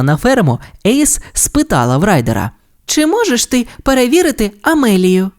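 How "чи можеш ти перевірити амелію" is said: high character voice, asking; girl pilot character. A narrator's reading. Ace asks Ryder to check her plane, high girl's voice. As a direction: ask the favour high-pitched.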